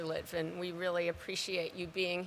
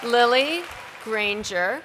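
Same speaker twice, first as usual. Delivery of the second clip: loud, ringing name announcement; calling out a name; 4 semitones higher, 9 dB louder than usual. Real, not acted. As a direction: announce the name to the whole hall. Announcing graduates' names to hall.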